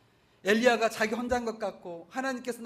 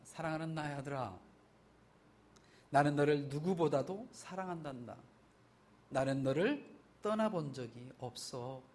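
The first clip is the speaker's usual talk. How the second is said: tender fatherly voice; 5 semitones lower, 3 dB quieter than usual. Retold or imitated. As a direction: speak gently to a hurting son. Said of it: "하나님께서 말씀합니다".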